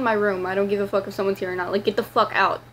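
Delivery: ordinary talk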